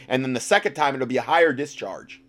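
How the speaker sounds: usual speaking voice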